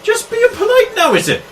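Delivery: high, indignant scolding; jealous accusation. Acted. Local girl confronting stranger over Nigel, high angry challenge.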